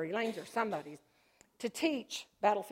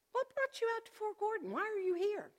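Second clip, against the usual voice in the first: quoted doctor; 12 semitones higher than usual. Retold or imitated. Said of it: "she said".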